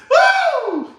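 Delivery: a sudden high whoop; high woo. Real, not acted. Reacting to the cover photo, high whoop. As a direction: let out a high mocking whoop. Exclaims after laugh.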